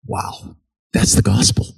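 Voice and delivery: breathy whisper of wonder; hushed, reverent whisper; whispered awe. Real, not acted. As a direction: drop to a hushed whisper, awestruck. Awed aside after preaching point.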